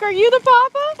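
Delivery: high, laughing "are you the papa"; mock paternity question. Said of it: "and be like".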